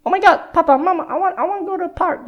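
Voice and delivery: put-on bouncy high child voice asking to go to the park; kid voice. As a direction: squeal it like a small kid pleading for the park. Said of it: "Larry is like".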